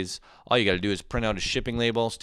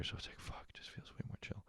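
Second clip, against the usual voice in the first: breathy whispered aside; half-whispered confession; 23 dB quieter than usual. Real, not acted. Muttered aside, unvoiced.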